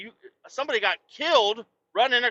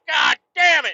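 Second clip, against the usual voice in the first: loud, high frustrated shout; yelled curse; irate shout; 6 semitones higher, 6 dB louder than usual. Retold or imitated. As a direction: blow up at the bad luck. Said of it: "'cause I'm so fucking irated".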